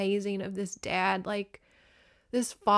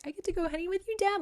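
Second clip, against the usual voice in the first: put-on excited little boy; 11 semitones higher, much faster than usual. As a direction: pipe up as a thrilled small son. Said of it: "And Red Wing's like".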